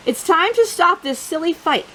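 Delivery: usual speaking voice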